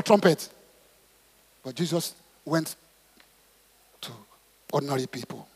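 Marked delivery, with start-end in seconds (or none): none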